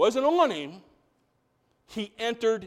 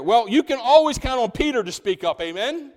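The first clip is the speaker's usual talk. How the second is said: ordinary delivery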